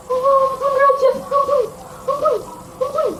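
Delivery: high-pitched, pleading whimper; mock crying plea. Servant begging the king for mercy, mock tearful. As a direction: beg in short, tearful bursts. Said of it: "Tuh dia menangis".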